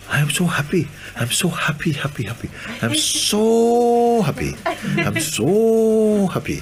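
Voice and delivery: high-pitched joy, building to long drawn-out "so"; overjoyed sadhu. Retold or imitated. Sadhu telling his teacher how happy he is, overjoyed.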